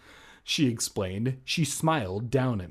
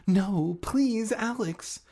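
put-on higher, pleading girl's voice calling down; 9 semitones higher, 3 dB louder, slower than usual. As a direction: beg as Hannah. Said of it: "I heard her call down".

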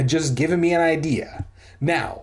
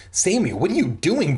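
mild high-pitched wail; a touch of distressed protest; 6 semitones higher than usual. A narrator's reading. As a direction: whine it in dismay, higher. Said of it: "Brent wailed".

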